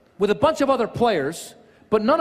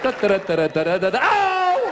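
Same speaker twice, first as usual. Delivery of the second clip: humming then yelp; 3 semitones higher than usual. Retold or imitated. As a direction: hum carelessly, then yelp in pain. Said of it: "I was like".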